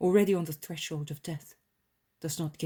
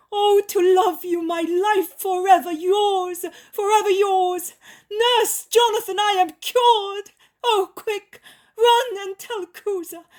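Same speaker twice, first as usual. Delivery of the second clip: much higher, louder ecstatic cries of joy; loud happy calling; 12 dB louder than usual. A narrator's reading. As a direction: shout out with overflowing joy. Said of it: "calling in a loud, happy voice".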